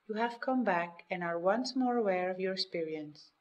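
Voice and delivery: normal talking voice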